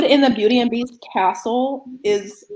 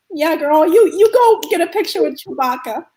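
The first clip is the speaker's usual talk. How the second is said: higher-pitched imitation of a friend egging you on; indulgent friend; 6 semitones higher than usual. Friend sending her off to Chewbacca, indulgently imitated. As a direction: humor the geek, pitched up. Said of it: "she was just like".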